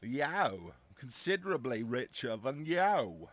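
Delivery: loud, drawn-out Brummie boast; exaggerated Brummie comedy character, loud; Brummie accent. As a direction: boast slowly in a hammed-up Birmingham accent. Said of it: "I'd say that was Brummie".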